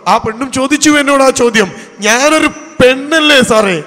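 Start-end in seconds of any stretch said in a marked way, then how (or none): 2.0-3.8 raised, drawn-out retold plea; high, emphatic appeal in a retold voice; anguished plea. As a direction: imitate a woman pleading, loud and slow. Retold woman's anguished plea to counsellor.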